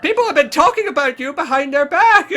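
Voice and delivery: loud, high hammed-up accusation; mock high-pitched tabloid reporter; shrieking girl reporter. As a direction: squeal it loudly, half laughing. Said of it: "this is a perfect voice for her".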